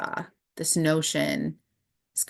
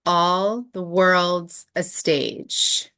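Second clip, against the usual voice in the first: slow recitation; 2 semitones higher, much slower than usual. Shown as a demonstration. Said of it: "slow recited Shakespeare line".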